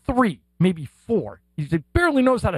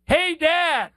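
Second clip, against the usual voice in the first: high, drawn-out mock call; imitated kid's yell; 9 semitones higher, much slower than usual. Son yelling to dad from the restaurant corner, imitated high kid call. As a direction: call across the room like a little boy. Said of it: "yells from the corner of the restaurant".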